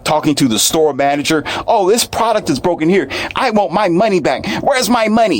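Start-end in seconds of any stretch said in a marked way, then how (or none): 1.7-5.4 hammed-up high Karen complaint; mock Karen complaining. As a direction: demand it high and whiny like a Karen. Imitating a Karen at store.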